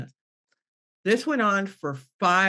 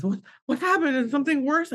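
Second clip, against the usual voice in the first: higher-pitched, anxious mock questions; alarmed husband; 7 semitones higher than usual. Husband answering his crying wife's call, alarmed. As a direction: imitate someone fearing bad news.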